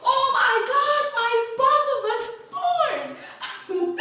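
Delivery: very high, loud, slow drawn-out shriek with laughter; panicked scream. Retold or imitated. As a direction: shriek in mock horror at the blood. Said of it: "and I screamed".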